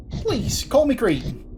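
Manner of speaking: Irish-ish Cockney character. Acted. Creed waving away formalities with the player, Irish-ish Cockney. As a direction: put on a high Irishy postman voice. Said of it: "supposed to be more Cockney than Irish".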